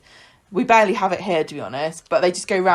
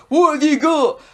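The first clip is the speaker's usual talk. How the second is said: scary tip worker; 6 semitones higher, 5 dB louder than usual. Retold or imitated. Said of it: "the scary man that works there ... says".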